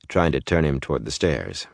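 plain reading voice

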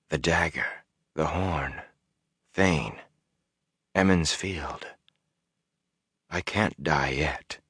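soft, slow, fading thoughts; quiet inner thought. A narrator's reading. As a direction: murmur as if far away. Inner thoughts near losing himself.

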